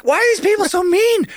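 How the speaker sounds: high, loud, wounded whine; hammed-up high whine about mean people; mock whine. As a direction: squeal it like a hurt kid. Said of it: "and I'm like".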